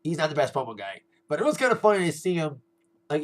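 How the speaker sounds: ordinary talk, nothing marked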